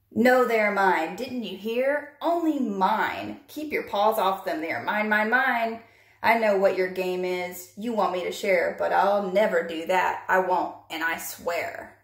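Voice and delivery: stomp every word like a greedy pug in a tantrum; flipping his wig. Acted. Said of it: "Pig flipped his wig".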